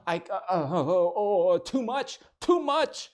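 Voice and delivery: stammering like choice is too much; flustered girlfriend. Retold or imitated. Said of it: "They're like".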